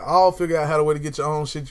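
ordinary talk

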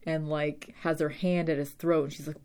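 ordinary delivery

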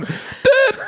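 very high robotic blips. Retold or imitated. Retelling Strennan freezing at the bench, high robot voice. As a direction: squeal it out as a malfunctioning robot. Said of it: "He was like a robot".

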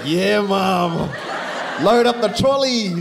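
put-on high, loud childish shout; excited kid shout. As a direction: yell it like a thrilled four-year-old. Kid excited at shopping trip.

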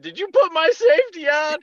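high-pitched accusing yell, re-enacted; indignant high shout, acted out; retold yell. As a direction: demand an answer in mock outrage. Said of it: "yelled the person's name. I said".

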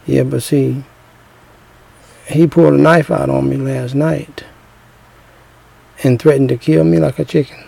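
soft, hushed confession; talking low. Retold or imitated. Wife tells her ex about the knife, softly. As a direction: keep it down, he's in the next room. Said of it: "'cause she talking low".

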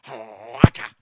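loud shouted Racha cry; Racha call. Shown as a demonstration. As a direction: belt out the Racha sound. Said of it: "I used to love doing the Racha".

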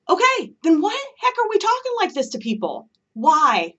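real frustration pouring out; passionate rant. Real, not acted. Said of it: "That was passion right there".

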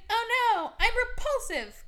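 high, loud mock horror; a hammed-up cry of horror; 1 mock dismay. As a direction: gasp it like a vain witch. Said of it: "she's like".

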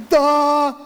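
a hint of a slow, dopey big brother; dim-witted Esau. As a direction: lightly play the dumb brother taking the deal. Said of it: "who is stupid as a rock".

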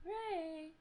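high, soft calling voice; softly calling for the cat Ray. Real, not acted. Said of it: "I'm trying to change the tone of my voice".

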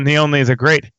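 announcer-style grand intro, slowing at the end; a hint of a grand announcer. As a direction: build it up like a showman. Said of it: "golf clap for that intro".

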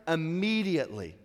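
"Immediately" stressed and falling; quoted emphasis. Shown as a demonstration. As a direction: lean on "Immediately" as the key word. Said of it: "That's how Mark's gospel reads".

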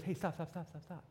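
soft, quick mock interruption; interrupting colleague. Colleague interrupting the preacher's read-through, hushed. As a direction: quietly cut in on the speaker.

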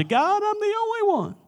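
high, soft mock whine; whiny voice. Elijah complaining to God in a high whine. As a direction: whimper it like a self-pitying child. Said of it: "in our whiny voice like Elijah".

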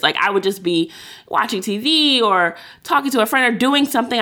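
ordinary talk, nothing marked

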